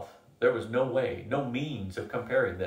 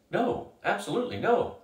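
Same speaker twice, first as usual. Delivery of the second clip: much higher, insistent no; emphatic refusal; 10 semitones higher than usual. Acted. Ethan refuses in daze.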